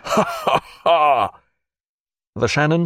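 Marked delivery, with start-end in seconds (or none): 0.0-1.4 a high, scornful ha ha ha; mocking laugh. Character laughs at the scow.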